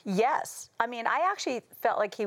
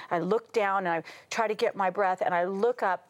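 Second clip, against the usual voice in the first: ordinary talk, nothing marked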